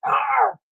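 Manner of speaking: sharp high strangled groan; frustrated groan. Real, not acted. Streamer angry at her memory, high frustrated groan. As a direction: squeeze out a fed-up groan.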